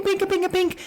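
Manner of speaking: high plinking punch noises; imitated clink sound. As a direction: chirp each pink up high like metal taps. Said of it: "something like pink".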